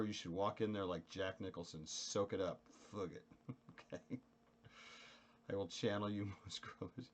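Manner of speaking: ordinary talk, nothing marked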